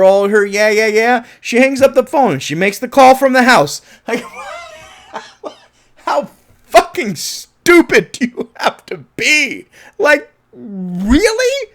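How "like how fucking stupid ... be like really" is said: high, loud, laughing disbelief; incredulous laughing rant. Real, not acted. Reviewer ranting at the character's dumb move, high and loud. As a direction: rant in outraged disbelief, cracking up.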